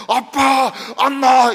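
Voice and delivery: fervent prayer cry; loud prayer call. Retold or imitated. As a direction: shout it out alone to God. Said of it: "아무도 없을 때는".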